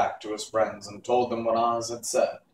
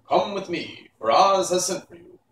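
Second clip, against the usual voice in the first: higher, louder soldier's call; summoning soldier; 8 semitones higher, 7 dB louder than usual. A narrator's reading. Green-whiskered soldier summons the Scarecrow, loud and high. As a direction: call him to the throne room.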